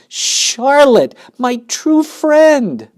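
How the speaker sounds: high, loud, slow sobbing wail. A narrator's reading. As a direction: wail through sobs. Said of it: "Great sobs racked his body".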